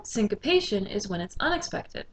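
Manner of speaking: ordinary delivery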